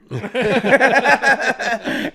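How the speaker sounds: high, loud burst of laughing; real laughter. Real, not acted. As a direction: burst out laughing after the punchline. Laughing at cop's punchline.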